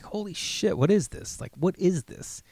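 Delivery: high, hushed "holy shit, what is this"; quietly floored, voice lifted up; stunned disbelief. Retold or imitated. Sitting with friend's dad, inwardly stunned and hushed. Stunned inner reaction at joint.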